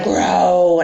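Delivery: loud growled word; animal growl. Acted. Beast's terrible voice described, loud growl. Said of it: "its terrible voice".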